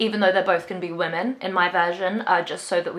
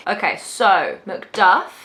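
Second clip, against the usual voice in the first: ordinary talk, nothing marked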